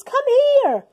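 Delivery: high loud call. Acted. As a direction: call them over eagerly. George calls Martians.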